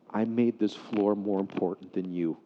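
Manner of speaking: low, subdued confession, no sobs; retold tearful confession. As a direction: soften into a humble admission. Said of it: "he began to cry".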